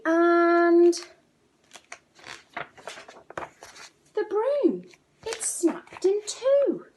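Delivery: high, loud and slowing; dramatic build. A narrator's reading. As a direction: build the crash to a peak. Broom breaks dramatically.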